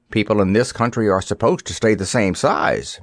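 ordinary delivery

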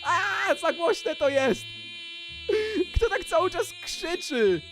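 high-pitched, drawn-out complaint; yelling over noise. Real, not acted. Player complains someone keeps screaming, high drawn-out yelling. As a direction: holler in protest over the din.

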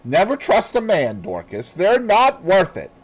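high, loud bitter warning; bitter mistress. Retold or imitated. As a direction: warn her with hard bitterness. Mistress voiced in retold scene.

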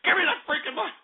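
snatch the mic back in a rage; furious demand. Real, not acted.

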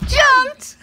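a gleeful storytime shout; excited. Acted. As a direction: launch the word like a big leap. Child jumping on bed, storytime.

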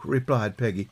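ordinary delivery

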